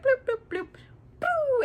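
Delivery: high-pitched little bloops, then a boo; cartoon sound effects for an arrow shot; playful high plinking noises; imitated bow noises. Imitates stringing and firing the bow.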